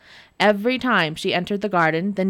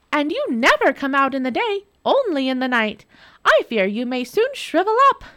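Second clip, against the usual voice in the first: high, sugary fake worry; mock concern; 7 semitones higher than usual. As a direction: fret sweetly to annoy him.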